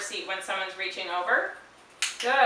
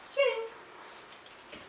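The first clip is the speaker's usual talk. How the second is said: soft call; 8 dB quieter than usual. Real, not acted. Calling the dog softly.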